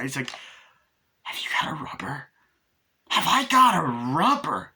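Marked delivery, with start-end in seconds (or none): 1.2-2.3 whispered acted question; hushed question. Tiffany stopping Chucky during sex, hushed asking. 3.1-4.7 loud, high, drawn-out mock disbelief; incredulous echo. Chucky answering Tiffany's question, loud hammed-up disbelief.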